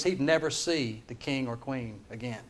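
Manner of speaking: normal talking voice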